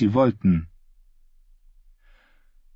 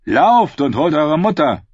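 calling out; 7 semitones higher, 6 dB louder than usual. Acted. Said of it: "rief Sir Heilin zu".

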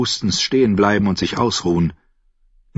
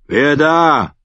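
a higher, louder call from the wall; challenging call; 7 semitones higher, 5 dB louder than usual. Acted. Crossbowman on the wall challenges the visitor, loud. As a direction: bark the challenge down.

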